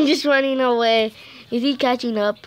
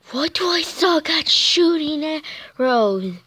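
ordinary talk

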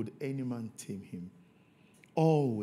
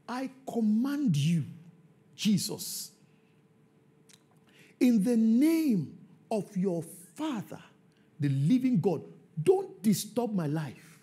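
demon's defiant reply; 9 semitones higher, 6 dB louder than usual. Shown as a demonstration. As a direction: hurl the demon's words back at Jesus, stretched out. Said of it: "Let me repeat".

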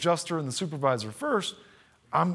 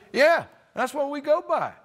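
easygoing rural official; 11 semitones higher, 4 dB louder than usual. Retold or imitated. Building head reassuring the caller, easygoing and high. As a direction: casually agree like it's obvious. County official voiced in story.

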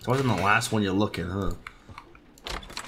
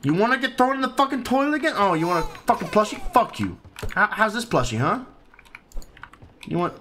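mock furious; 9 semitones higher than usual. Real, not acted. Yelling at the game baby.

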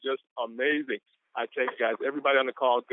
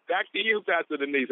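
normal talking voice

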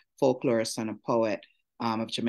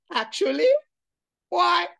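imitating his fake English accent, higher; mocking his twang; 5 dB louder than usual. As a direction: parrot his snooty twang back at him. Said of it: "And now all you can say is".

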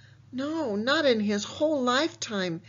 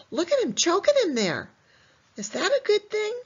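high, emphatic teacher quiz; 10 semitones higher than usual. Acted. Teacher quizzing kids, answering herself.

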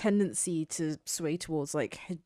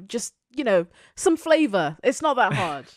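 exasperated burst; 6 semitones higher, 6 dB louder than usual. Real, not acted. Exasperated point about representation.

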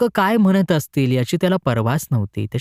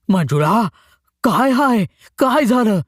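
panting question; 8 semitones higher, slower than usual. Acted. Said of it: "सुखोबानं धापा टाकीत विचारलं".

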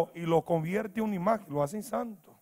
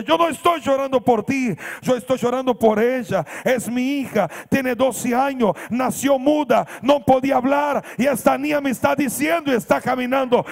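high, loud, impassioned testimony; shouted, building emotional plea; retold tearful outcry; 5 semitones higher, 9 dB louder than usual. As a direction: imitate a weeping father crying out, phrase by phrase. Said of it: "seguía insistiendo y lloraba y lloraba".

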